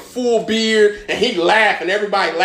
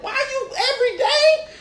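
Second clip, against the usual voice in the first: very high-pitched, helpless whine of disbelief; incredulous protest. Retold or imitated. Small freshman asking his bully why, incredulous. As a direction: squeak it out as an exasperated question.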